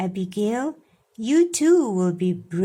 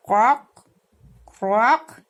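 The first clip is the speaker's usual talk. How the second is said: put-on louder croaking noise for the children; frog croak; 4 dB louder than usual. Shown as a demonstration. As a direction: show them a frog's croak. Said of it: "How does a frog croak".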